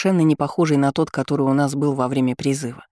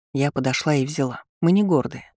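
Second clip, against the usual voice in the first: plain reading voice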